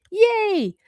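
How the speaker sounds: high delighted squeal of joy; excited cheer. Real, not acted. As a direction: cheer like a thrilled fan. Cheering Egg's arrival.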